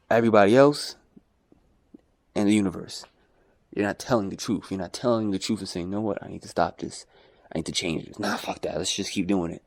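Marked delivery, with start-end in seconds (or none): 5.9-9.6 mock inner voice. Voicing people lying to themselves.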